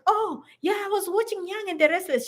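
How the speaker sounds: a lightly mocked younger self boasting; eager newcomer. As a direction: proudly chat about your soap opera. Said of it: "So I was busy telling her".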